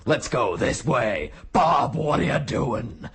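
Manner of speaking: slow, put-on tough soldier voice; mock gruff soldier voice. Mocking every Killzone soldier to his co-host, slow tough-guy voice. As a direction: drag it out like a growly game grunt.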